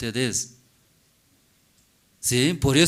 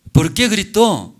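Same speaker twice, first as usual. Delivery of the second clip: usual speaking voice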